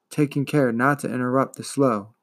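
ordinary delivery

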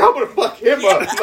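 high, loud, laughing bluster; comic outraged threat. Retold or imitated. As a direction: threaten loudly in mock fury. Comic threat against the girl's dad.

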